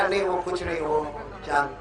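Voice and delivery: low, soft, embarrassed mumble; sheepish reply. Retold or imitated. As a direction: answer like a caught husband. Caught husband answers sheepishly.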